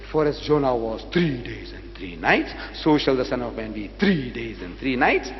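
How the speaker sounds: "three" drawn out and leaned on; slow quoted verse, stretching each "three"; emphasis on three. Retold or imitated. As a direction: hit "three" long, lifting at "nights". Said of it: "he was emphasizing the time factor".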